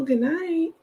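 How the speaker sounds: good night lifted high; playful high sign-off; sing-song good night. Shown as a demonstration.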